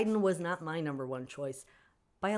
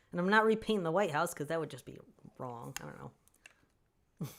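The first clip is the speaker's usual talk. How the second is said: ordinary delivery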